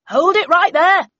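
high, loud shout of authority; loud command. A narrator's reading. Agent vaults the wall and shouts a command to stop. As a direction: stop them in their tracks. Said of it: "said a loud voice".

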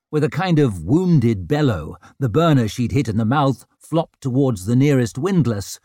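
ordinary delivery

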